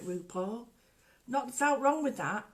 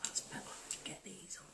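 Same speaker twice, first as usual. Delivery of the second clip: hushed mutter putting glasses on; barely there whisper; whispered aside; 5 semitones lower, 16 dB quieter than usual. Real, not acted. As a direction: whisper to yourself.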